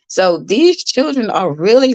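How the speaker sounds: normal talking voice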